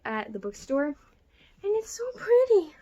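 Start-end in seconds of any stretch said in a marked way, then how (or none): 1.6-2.7 higher, slow, cooing over the cover; cooing gush. Real, not acted. Showing the cover to viewers, cooing higher and slow. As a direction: coo over it like something adorable.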